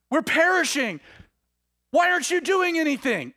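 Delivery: high, loud frantic cry. Retold or imitated. Disciples waking Jesus in the storm, high loud cry. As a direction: yell it in panic over the storm. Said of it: "they're crying out".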